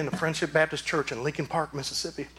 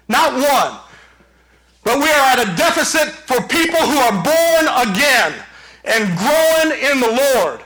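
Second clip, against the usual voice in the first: impassioned preaching; 8 semitones higher, 6 dB louder than usual. Real, not acted. Pastor urging his church to fight dead religion, preaching forcefully. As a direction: drive each phrase home forcefully.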